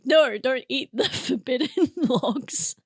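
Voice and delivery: slow warning broken up by a laugh. Real, not acted. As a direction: crack up while warning them off. Joking warning, said through laughter.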